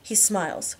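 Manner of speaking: plain reading voice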